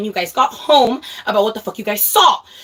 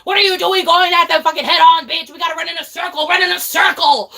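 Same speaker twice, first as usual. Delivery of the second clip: high-pitched, frantic retold shouting; angry gamer yelling; 7 semitones higher, 4 dB louder than usual. Streamer re-enacts ordering her friend around in zombies, angry. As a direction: yell orders at a useless teammate. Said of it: "I would get so angry".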